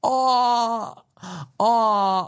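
high whimpering aw, mocking; teary mock moan; mock crying. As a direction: fake a crybaby's whine. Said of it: "sounds like he's about to cry".

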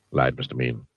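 usual speaking voice

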